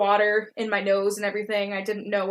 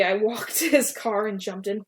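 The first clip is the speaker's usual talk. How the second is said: plain reading voice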